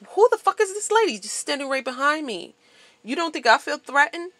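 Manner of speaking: retold outrage. Retold confrontation at gas pump.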